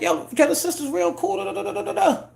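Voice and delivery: high sing-song mock of own naive answer; high mocking self-imitation. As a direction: mimic your clueless younger self, squeaky and breezy. Repeats naive reply, mocking.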